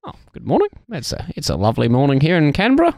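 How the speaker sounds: put-on Australian accent, swooping up at line ends; hammed-up Aussie lilt. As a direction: do a comic Australian with leaping, rising endings. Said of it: "can't even do an Australian accent".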